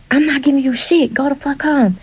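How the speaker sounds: retold yell. The man shouting back from the street, mock yell. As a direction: hurl it back out the window. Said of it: "yelling out the windows".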